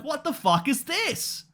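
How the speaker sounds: high-pitched stunned outcry, slowed on the questions; reliving the shock of reading the chapter; squeaky, incredulous fan freakout; shocked disbelief. Retold or imitated. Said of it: "And now I'm like".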